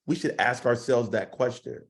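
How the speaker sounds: ordinary delivery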